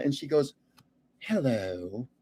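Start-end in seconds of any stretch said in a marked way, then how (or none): 1.2-2.1 a low, soft, breathy hello, mildly imitated; soft mock hello. Marla Maples greeting the starstruck fan, a soft low hello. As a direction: coo it back lightly, barely voiced.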